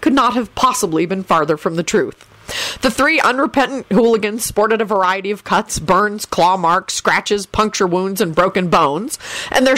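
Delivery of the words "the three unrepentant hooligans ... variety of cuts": plain reading voice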